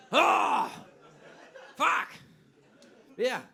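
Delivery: hammed-up caught-out cry; mock dismayed shout. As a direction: yelp it like you just got caught. Caught out about the podcast.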